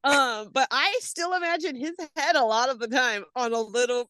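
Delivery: high, giggling through the words; laughing. Real, not acted. Friend can't stop laughing at the baby image. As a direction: keep breaking into laughs.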